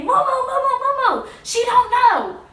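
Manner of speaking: high, loud mock scream of a name; imitated screaming. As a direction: yell the name like a panicked crowd. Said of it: "They started screaming his name".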